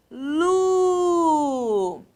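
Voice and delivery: LU held very long; drawn-out syllable. Shown as a demonstration. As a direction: stretch the vowel of "Lu" right out. Teacher sounds out a syllable. ("Lu" bem prolongado)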